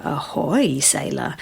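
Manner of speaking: low, soft, sultry announcement; sexy lady announcer. Retold or imitated. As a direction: purr it like a seductive cruise announcer. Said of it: "a very sexy lady voice".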